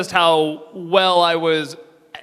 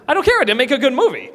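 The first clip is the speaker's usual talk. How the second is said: high, fast comic comeback; mock exasperated retort; 9 semitones higher, 3 dB louder, much faster than usual. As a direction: fire back in playful exasperation. Acted retort to protesting fans.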